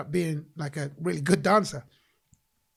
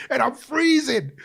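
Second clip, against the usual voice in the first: slow laughing, higher pitch; 7 semitones higher, 5 dB louder, slower than usual. Real, not acted. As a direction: laugh it out slowly. Laugh on freezing memory.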